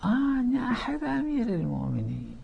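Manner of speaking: high, soft voice of an imagined claimant; voicing someone else. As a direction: put on the voice of another person's claim. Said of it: "مو كلامي هذا، أنا أقول كلام الغير".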